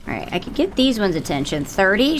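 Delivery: usual speaking voice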